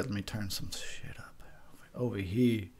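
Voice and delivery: muttered whisper to himself. Real, not acted. Talking to himself while turning the sound up, whispering. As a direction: whisper it while fiddling with the volume. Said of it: "I can't hear shit".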